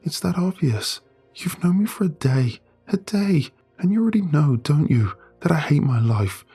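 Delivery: high, tearful voice near breaking; words catching in a tight throat; choking up. Acted. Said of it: "She chokes out her words".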